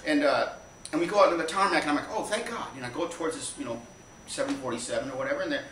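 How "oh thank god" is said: high-pitched sigh of thanks; mock relief, pitched up; retold relief. Passenger spotting a big plane on tarmac, relieved.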